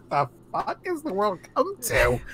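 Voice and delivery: high, soft, baffled; incredulous. Retold or imitated. Mocking a lawyer ad with a lion, high baffled aside. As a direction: ask it in quiet disbelief. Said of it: "I'm like".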